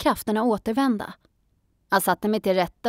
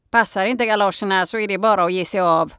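put-on gruff old man; 5 dB louder, faster than usual. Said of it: "sa han".

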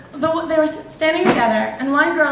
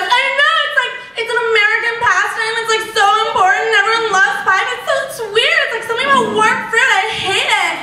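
put-on excited girly voice; 7 semitones higher, much faster than usual. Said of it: "she goes".

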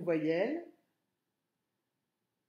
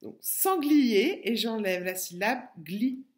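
"sanglier" slow and louder, syllables drawn out; teacher repeating the word slowly for children; 2 semitones higher, 4 dB louder than usual. Shown as a demonstration. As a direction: hammer out "sanglier" for the class. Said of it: "je répète".

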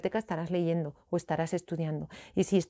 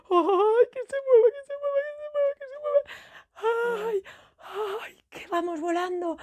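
high squealing, thrilled and scared; excited squealing; 13 semitones higher, 5 dB louder than usual. Pretend. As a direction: squeal as the chair takes off. La silla voladora se mueve. (chillando emocionada)

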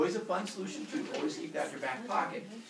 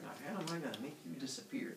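scheming mutter; 9 dB quieter, faster than usual. Pretend. Kronos scheming aside.

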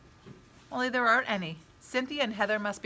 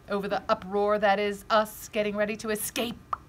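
ordinary delivery

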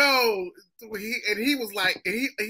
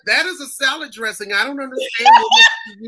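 mock exasperation; 5 semitones higher, 9 dB louder than usual. Acting himself correcting people, indignant.

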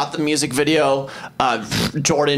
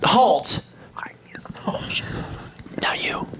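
whispered; 7 semitones higher, 5 dB quieter, slower than usual. Acted. Dramatic call to the camera.

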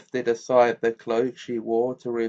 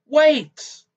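sudden high loud cry of panic; shout; 9 dB louder than usual. A narrator's reading. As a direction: blurt it out, desperate and loud. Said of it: "He had not meant to shout".

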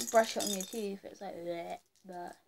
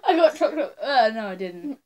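laughing; 9 dB louder than usual. Real, not acted. Laughing after tasting.

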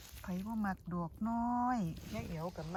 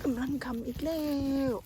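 higher, delighted rising squeal; excited repeat; 6 semitones higher, 6 dB louder than usual. Real, not acted. Vlogger thrilled at another mushroom patch, squealing it again.

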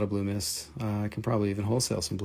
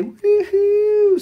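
high, loud whoop; joyful payday whoop; cheer; 13 dB louder than usual. Real, not acted. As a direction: celebrate payday with a shout.

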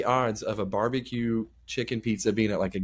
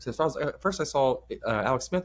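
normal talking voice

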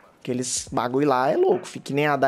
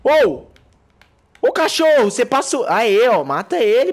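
shouting; 7 semitones higher, 6 dB louder than usual. Real, not acted. Yelling at teammates mid-fight.

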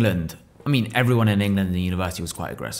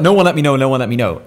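higher, louder repeated protest; retold panic; 4 semitones higher, 7 dB louder than usual. Protesting to the court caller, flustered and loud. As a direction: plead it fast and flustered. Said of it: "I was like".

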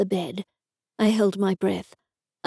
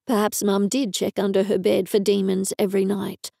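ordinary delivery, nothing marked

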